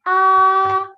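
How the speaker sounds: AH held long on a high, level pitch; sustained high level AH; first tone. Shown as a demonstration. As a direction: stretch AH out loud as a flat high line. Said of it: "That is your first tone".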